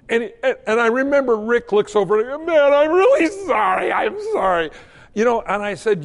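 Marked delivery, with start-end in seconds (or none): 2.5-4.7 high, loud mock-anguished apology; hammed-up pleading sorry; imitated distraught apology. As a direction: blurt it out, voice pitched way up, then dropping. Acted quote of cowboy's stunned apology.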